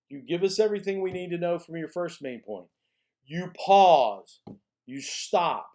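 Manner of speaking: ordinary talk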